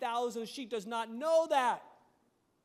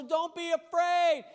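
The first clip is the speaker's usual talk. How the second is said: loud, slow reassurance; firm command; 4 semitones higher, 7 dB louder, slower than usual. Retold or imitated. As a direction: plant it firmly and slowly. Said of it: "Elijah tells her".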